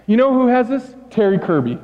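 ordinary talk

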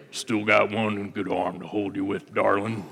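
low, soft cowboy drawl; an old cowboy murmuring to his sweetheart; husky Western leading man; Sam Elliott voice. Retold or imitated. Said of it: "That's my best, uh, Sam Elliott impersonation".

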